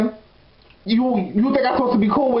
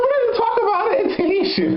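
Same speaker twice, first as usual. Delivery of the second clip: high, laughing protest; 12 semitones higher than usual. Retold or imitated. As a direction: laugh out the denial. Laughing retort to his complaint.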